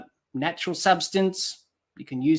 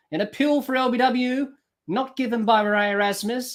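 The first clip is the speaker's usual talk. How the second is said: live play-by-play; 6 semitones higher, 4 dB louder than usual. Acted. Commentator calls the ball live.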